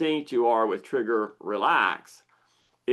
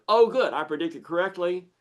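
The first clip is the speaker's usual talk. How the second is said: pleased lizard brain; 6 semitones higher, 3 dB louder than usual. Acted. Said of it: "And the lizard brain goes".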